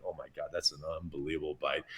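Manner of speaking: low, hushed, hurried aside; awed inner thought. Retold or imitated. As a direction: murmur it quickly to yourself. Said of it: "I'm just thinking".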